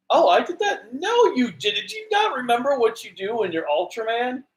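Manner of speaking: high-pitched, incredulous imitation; mock disbelief. Mimicking him not realizing he did that, incredulous. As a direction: question it as if baffled he forgot.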